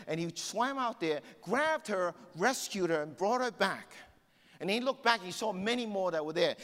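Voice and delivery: high, loud sermon storytelling; impassioned preacher telling a story; fired-up preaching. Acted. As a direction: preach the rescue with rising urgency. Preaches the rescue story fervently.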